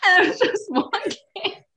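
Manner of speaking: laughing while upset; breaking into helpless laughter; laughing into sobs. Real, not acted. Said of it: "Why am I laughing?".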